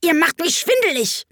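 high-pitched irritated complaint; irate queen, fed up; heated. A narrator's reading. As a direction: scold them in a high queenly voice. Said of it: "eiferte die Königin".

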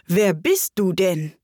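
slow, scornful question; contemptuous. A narrator's reading. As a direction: draw it out with disdain. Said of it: "sagte die Raupe verächtlich".

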